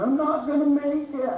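high, slow, hammed-up despair; mock panic. Relative stumbling down the stairs, hammed-up high wail. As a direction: wail like you won't escape.

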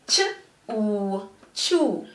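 short "ch", long "oo", then "chew" joined; sounding out and blending. Shown as a demonstration. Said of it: "blend the syllables together".

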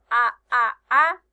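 A sounded out loud, one at a time; loud, separate open A vowels; letter sound A. Shown as a demonstration. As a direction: give each A its own beat. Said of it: "el sonido de la letra".

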